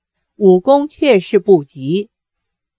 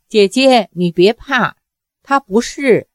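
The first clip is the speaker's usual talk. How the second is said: ordinary delivery, nothing marked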